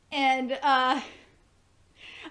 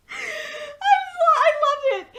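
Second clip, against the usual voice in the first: laughing delight; 7 dB louder than usual. Real, not acted. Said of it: "delighted laughter".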